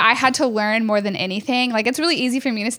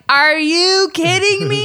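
high, loud, drawn-out disbelief; retold outraged disbelief; incredulous; 9 semitones higher, 5 dB louder, slower than usual. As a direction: stretch it out, climbing in exasperation. Retold confrontation with upset customer.

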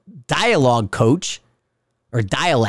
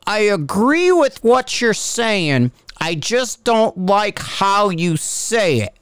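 slow, higher-pitched mock critic; imitated critic; 5 semitones higher, slower than usual. Ranter mimicking online critics who use the same line, slow mocking voice. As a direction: draw out the complaint in a mocking voice. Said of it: "It's like".

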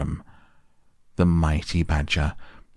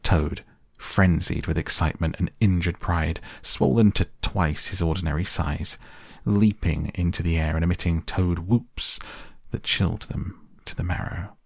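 ordinary delivery, nothing marked

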